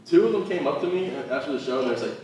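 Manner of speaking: ordinary talk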